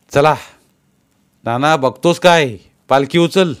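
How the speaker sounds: rally him to lift the palanquin now; commanding shout. Acted. Tukaba orders the palanquin lifted. (नानाला पालखी उचलायला मोठ्याने, ठामपणे दिलेला हुकूम)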